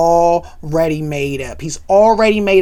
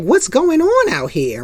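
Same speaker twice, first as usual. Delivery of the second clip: stern mother; 8 semitones higher than usual. Retold or imitated. Claire demanding.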